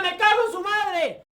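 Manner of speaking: high shouted Spanish curse. Acted. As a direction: yell it furiously in Spanish.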